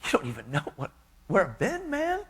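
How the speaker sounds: high, pleading mock protest; retelling his own incredulous pushback. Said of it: "And I said".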